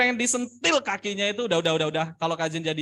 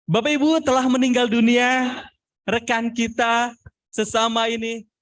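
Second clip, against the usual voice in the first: loud death announcement; 2 semitones higher, 5 dB louder than usual. Shown as a demonstration. Trainer demonstrates announcing a death to an audience, loud and raised. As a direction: project the death notice out to the crowd. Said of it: "inilah ekspresi wajah ini penting".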